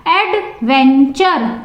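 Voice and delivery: Adventure slowly broken as Ad-ven-ture; split into syllables. Shown as a demonstration. Teacher showing students three syllables, Adventure split as Ad-ven-ture. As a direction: say Adventure in three separate beats. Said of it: "three syllables".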